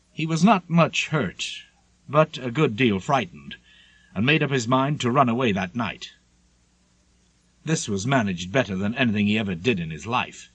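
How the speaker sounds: usual speaking voice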